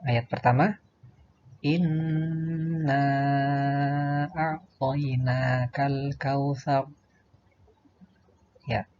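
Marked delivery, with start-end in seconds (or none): 1.7-6.9 slow, sustained recitation with long held vowels; Qur'an recitation. Shown as a demonstration. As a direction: recite it slowly as a model for pupils. Contoh bacaan ayat. (tilawah pelan dengan bacaan panjang)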